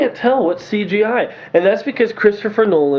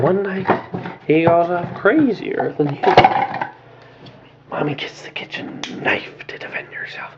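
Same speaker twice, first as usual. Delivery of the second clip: soft whispery Joker storytelling, much quieter; Heath Ledger's Joker; 4 dB quieter than usual. Retold or imitated. Reviewer recites the Joker's knife story in a quiet whisper. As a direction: hush it like a menacing clown telling a tale. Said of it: "the way he spoke".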